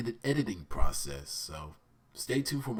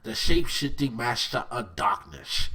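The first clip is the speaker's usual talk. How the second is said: Aku impression; 6 dB louder than usual. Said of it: "You know, like that".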